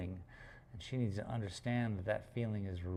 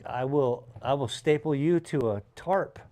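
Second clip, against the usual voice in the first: stern and louder threat to the puppy; serious warning voice; 4 semitones higher, 6 dB louder than usual. Shown as a demonstration. Owner scolding his chewing puppy, stern and loud. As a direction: warn her like you mean it. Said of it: "When I start getting serious in my voice".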